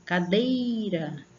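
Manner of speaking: Cadeira slow, with DEI drawn out; sílaba forte. Shown as a demonstration. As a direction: hold the DEI in Cadeira like a call. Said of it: "a gente sente puxar aqui". (Cadeira com o DEI alongado)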